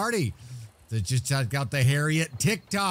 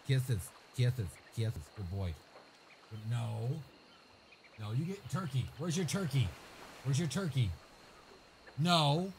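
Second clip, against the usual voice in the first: talking to the dog; 8 dB quieter than usual. Real, not acted.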